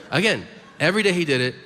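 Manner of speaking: ordinary delivery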